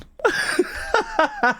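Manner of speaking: high-pitched real giggle; helpless high laughter; giggling. Real, not acted. Amused at typing the command.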